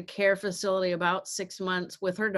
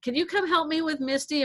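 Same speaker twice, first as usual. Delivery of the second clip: husband asking; 8 semitones higher than usual. Retold or imitated. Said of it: "he's like".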